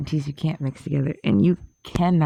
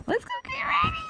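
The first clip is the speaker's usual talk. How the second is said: high squealing call; excited high-pitched call; playful shout; faster than usual. Real, not acted. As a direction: yell it out giddy.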